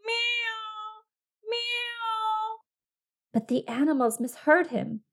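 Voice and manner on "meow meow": high excited cat meows; excited meowing. Acted. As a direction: meow with all your might. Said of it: "cried excitedly with all his might".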